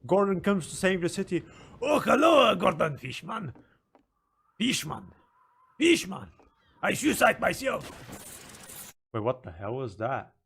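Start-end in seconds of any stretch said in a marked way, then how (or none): 1.8-8.9 put-on loud high English-accented fisherman greeting, slowing at the end; hammed-up English accent, shouting the name; mock English accent. Streamer imitating the English-accented fisherman to chat. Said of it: "He speaks in an English accent".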